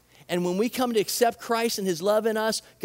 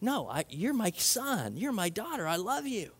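much softer, gentle and loving; tender voice of God; 8 dB quieter than usual. Retold or imitated. God speaking to his kid, softer and tender. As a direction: speak it like a father reassuring his child. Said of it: "It's like".

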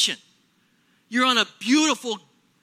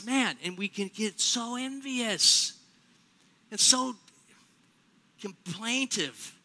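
slow lament; 4 dB quieter, slower than usual. Real, not acted. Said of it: "slow lament".

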